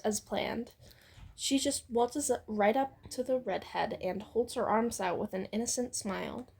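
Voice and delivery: plain reading voice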